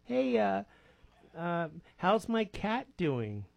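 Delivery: softly acted sensitive kid brother; sensitive younger brother. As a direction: raise the pitch a little, hesitant and slow. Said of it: "kinda real sensitive".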